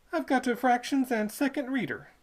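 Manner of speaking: put-on high, eager young boy; a country lad answering, pitched high; boy's voice. Boy answering the toll keeper, high and eager.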